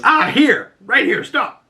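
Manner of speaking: high, loud put-on voice calling stop; squeaky comic shout to stop the car; mock Deadpool. Imitating the teen telling the driver to stop.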